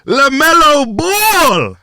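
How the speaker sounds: high, slow, stretched-out name call; hype announcer. Acted. Hyped name reveal, drawn out.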